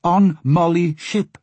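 slow, high porpoise pidgin; porpoise repeating. Acted. Porpoise repeats to puzzled Asta, slow and high. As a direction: raise the pitch and draw out each word.

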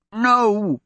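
protesting. Acted. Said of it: "the prince protested".

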